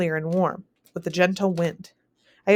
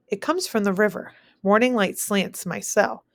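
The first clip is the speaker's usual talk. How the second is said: usual speaking voice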